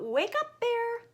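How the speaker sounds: high call to wake Bear. Acted. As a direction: sing it out to a sleeper. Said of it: "Hare called out".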